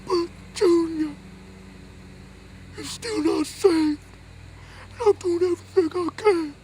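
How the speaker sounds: mother protesting to the preacher, mock tearful; hammed-up mama sob. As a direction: whimper like a mother near tears. Mama answers pastor, crying.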